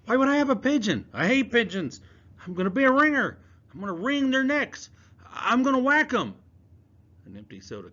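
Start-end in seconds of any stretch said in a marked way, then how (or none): 0.0-6.3 put-on high, loud boy voice, laughing it off; lightly voiced scared boy faking toughness; nervous laughing denial. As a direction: brush off the accusation with forced bravado. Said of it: "Palmer laughed".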